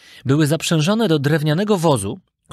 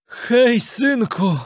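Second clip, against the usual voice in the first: father calling out, pitched higher; Geppetto; 8 semitones higher, much slower than usual. A narrator's reading. Father calling his son inside the fish, calling out. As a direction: hail your son from afar. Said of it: "usłyszał głos Geppetta".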